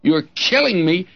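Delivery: mock yell, first cry pitched high; a boy hollering under a switch, half-acted; hammed-up childish yelling; retold yell. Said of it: "I would yell at the top of my voice".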